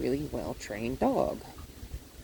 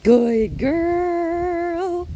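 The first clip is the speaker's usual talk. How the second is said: very high, loud, long-held praise; sing-out praise pitched way up; dog praise; 11 semitones higher, 7 dB louder than usual. Real, not acted. Trainer rewards the dog, high drawn-out praise.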